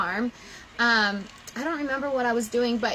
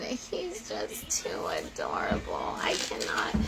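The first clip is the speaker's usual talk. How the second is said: whispering, overcome with adoration; awed whisper; 7 dB quieter than usual. Real, not acted. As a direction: breathe it out in a whisper, too moved to speak. Gushing over son's video.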